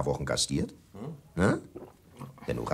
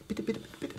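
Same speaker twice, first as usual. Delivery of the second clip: hushed aside; 11 dB quieter, faster than usual. Acted. Host hushing gossip to guests.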